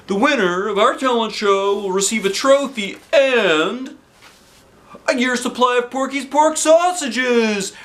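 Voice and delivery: high, bright showman's welcome; announcer voice. Acted. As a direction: announce it to the crowd. Principal announcing prize.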